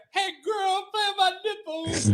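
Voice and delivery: high, breathy-sounding falsetto tease; mock girly voice. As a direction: squeak it out like a dainty girl. Mocking a feminine big man.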